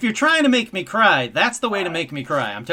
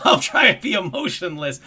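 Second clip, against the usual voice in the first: mock emotionless. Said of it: "monotone and emotionless".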